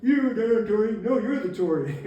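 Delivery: loud, high hammed-up accusations; mock quarrel. Confused fighters accuse each other, hammed-up angry shout. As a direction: shout it back and forth in mock outrage.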